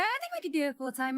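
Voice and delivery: normal talking voice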